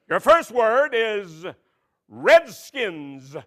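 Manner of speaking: slow, loud word reading; spelling bee announcer. Pretend. Host giving contestant the word, slow and loud. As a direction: pronounce it clearly for the contestant.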